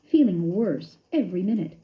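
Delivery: ordinary delivery, nothing marked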